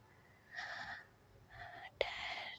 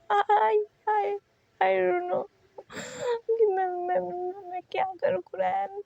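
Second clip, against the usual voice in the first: crying helplessly; 3 semitones higher than usual. A narrator's reading. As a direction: stammer helplessly through tears. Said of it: "फूट फूट कर रोने लगी". (रोते हुए, हकलाती ऊँची आवाज़)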